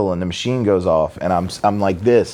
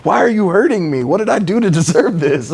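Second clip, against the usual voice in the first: horrified whining; 12 semitones higher, 3 dB louder than usual. Retold or imitated. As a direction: wail like a tough guy suddenly horrified, hammed-up. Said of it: "they just look at you horrified".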